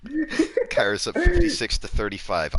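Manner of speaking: high, loud mocking echo; mock announcer. Real, not acted. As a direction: repeat it like a sportscaster. Teasing repeat of score.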